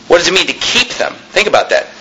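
ordinary talk

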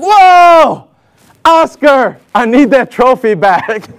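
loud high whoa, then firm recall; acted sudden realization, slowing at the end; alarmed coach. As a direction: imitate a coach catching a blunder.

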